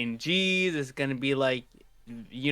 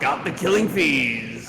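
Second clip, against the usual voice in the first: voice changer; 5 dB louder, slower than usual. Acted. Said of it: "my voice changer was off".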